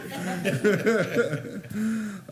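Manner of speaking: long, high-pitched real laugh; big laugh that tails downward. Real, not acted. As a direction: crack up at a colleague's joke. Chair reacts to member's joke.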